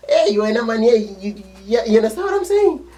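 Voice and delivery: very high, loud jeering voice; shrill it out like a teasing kid; mocking taunt. Kids teasing each other.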